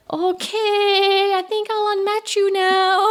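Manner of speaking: high, drawn-out mock-polite dismissal; sing-song brush-off. Woman retelling how she ended a dating-app chat, sing-song brush-off.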